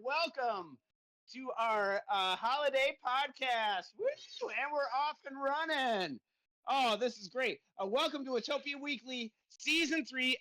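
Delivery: ordinary talk, nothing marked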